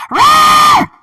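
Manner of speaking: loud, high screeched roar; short piercing creature shriek; dragon screech. Acted. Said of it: "a screech".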